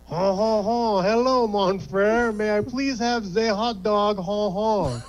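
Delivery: a smug laughing line cutter, slow on mon frere; lightly voiced smug French-ish cutter. Line cutter charming the cashier, smug and chuckling.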